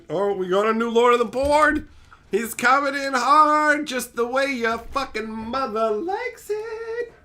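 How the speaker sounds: mock announcer voice, drawn out; hype announcer. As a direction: announce the newcomer like a ring MC. Announcing new top donor.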